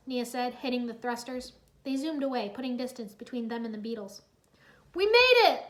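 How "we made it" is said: high, loud joyous whoop. Acted. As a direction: celebrate the escape. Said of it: "Lloyd whooped".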